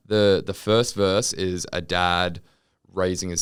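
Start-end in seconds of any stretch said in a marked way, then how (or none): none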